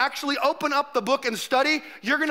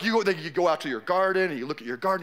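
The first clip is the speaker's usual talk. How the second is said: ordinary talk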